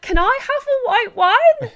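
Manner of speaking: hammed-up shy request, high and slow; mock timid ask. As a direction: squeak it out like a nervous boyfriend. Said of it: "he was like".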